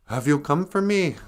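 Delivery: a hint of an awed old woman; old Jamaican lady. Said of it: "this old lady from Jamaica".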